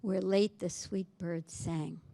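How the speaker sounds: quiet lingering verse; hushed recital. Shown as a demonstration. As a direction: let the line fade away.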